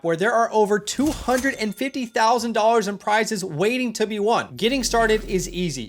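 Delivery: upbeat sponsor read; lively sponsor plug. Acted. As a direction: pitch the app like a host ad.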